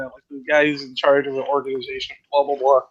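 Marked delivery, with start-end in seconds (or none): none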